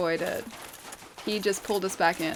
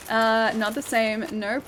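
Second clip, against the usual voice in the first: usual speaking voice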